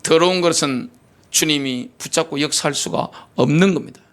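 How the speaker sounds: usual speaking voice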